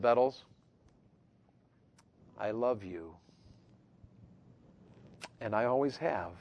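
soft, low, quiet tenderness; father-in-law's tender words. Retold or imitated. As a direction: murmur it lovingly. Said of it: "He said".

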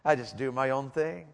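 lightly voiced sullen teen; mumbled kid voice. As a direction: mimic the kid's shrug of a line. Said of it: "He said, uh".

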